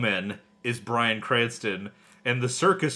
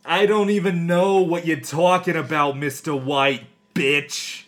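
Yiddish-tinged Jesse, louder, drawled; lazy Jesse with a Yiddish lilt; 5 semitones higher, 6 dB louder than usual. Acted.

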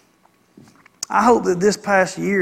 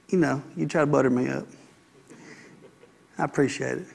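ordinary talk, nothing marked

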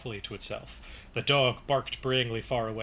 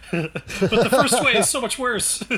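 laughing; 8 semitones higher, 6 dB louder than usual. Real, not acted. Laughing at vulgar quote.

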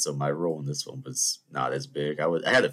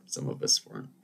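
ordinary talk

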